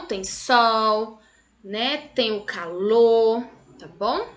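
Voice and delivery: ordinary talk, nothing marked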